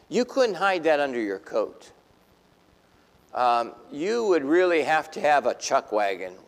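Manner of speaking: ordinary talk, nothing marked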